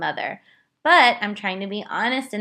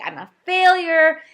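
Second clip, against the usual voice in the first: much higher and louder, breaking into sobs; retold sobbing; 6 semitones higher, 7 dB louder than usual. Mother reliving her potty-training meltdown, sobbing and louder. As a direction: wail it like you're falling apart. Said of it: "and I'm sobbing".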